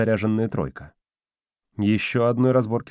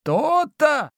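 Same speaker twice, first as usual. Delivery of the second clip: put-on high, gleeful old spirit; squeaky triumphant giggle; giggling domovoi. A narrator's reading. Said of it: "хихикнул старик".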